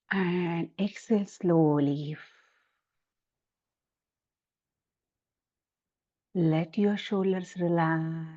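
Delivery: slow, calm meditation guide voice. Acted. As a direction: lead the breath slowly and gently. Guides opening breathing exercise.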